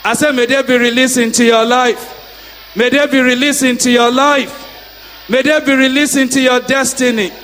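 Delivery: loud, rhythmic decree; preaching decree. Acted. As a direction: call it out over the crowd. Decreeing blessings to congregation.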